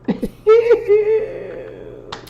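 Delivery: high, shrieking laugh of joy; delighted squealing laugh. Real, not acted. Delighted reaction to chat.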